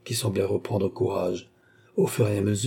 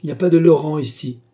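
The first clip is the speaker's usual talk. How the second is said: loud, high, rude retort; gruff-mannered guard, raised voice; rough rebuff; 6 semitones higher, 7 dB louder, faster than usual. A narrator's reading. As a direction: refuse them roughly through the door. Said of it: "répliqua rudement la voix".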